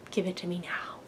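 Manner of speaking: whispered mock demand; hushed craving. Viewer coveting everything in the picture, whispered demand.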